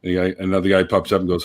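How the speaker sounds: usual speaking voice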